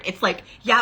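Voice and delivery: ordinary talk, nothing marked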